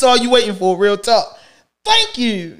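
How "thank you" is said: lightly mimicked phone prompt; phone recording imitation. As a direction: put on a phone-message voice, slightly raised. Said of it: "It say".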